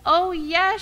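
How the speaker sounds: very high, loud shout of joy; joyful shout. Retold or imitated. Fayette dancing round the fellowship hall, shouting. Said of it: "she shouted".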